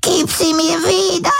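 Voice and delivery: put-on an old woman sobbing to get it back; sobbing plea. A narrator's reading. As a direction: beg through tears. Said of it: "wimmerte sie".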